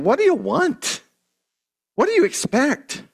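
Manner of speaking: high, mocking whiny question; mock them with a whine; sarcastic whine. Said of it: "they're being sarcastic".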